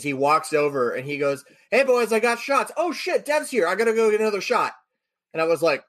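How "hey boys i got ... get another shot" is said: high-pitched, excited bar greeting; excited friend. Retold or imitated. Friend greets the boys with shots at the bar, excited. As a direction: call it out high, like a buddy on a roll.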